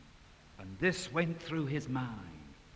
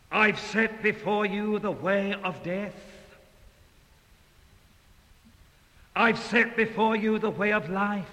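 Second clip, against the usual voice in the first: loud, raised proclaiming voice; Moses proclaiming; 6 semitones higher, 7 dB louder than usual. Retold or imitated. Moses to the Israelites, loud proclaiming. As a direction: call it out high like the old patriarch. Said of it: "And he says this to the Israelites".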